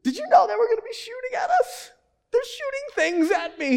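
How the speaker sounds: high-pitched whiny wail of a panicked recruit; hammed-up tearful complaint to the commanding officer; mock crying. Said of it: "you're crying".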